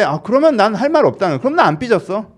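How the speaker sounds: acting out a huffy friend, high and loud; sulky. Imitating a friend who refuses to discuss it, sulky. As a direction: shut down the talk in a mock sulk. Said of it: "뭐 때문에 삐졌는데".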